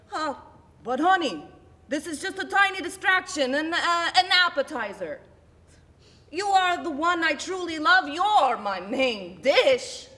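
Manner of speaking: high, loud, overdone sweet-talk; pleading cheating husband. Acted. Cheating husband placating his wife, hammed-up pleading.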